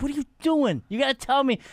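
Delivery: high, angry retort; lost it. Retold or imitated. Comic retelling how he yelled at a sketch partner, high and angry. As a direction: snap back, upset after a rock hit your head. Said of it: "I, I lost it".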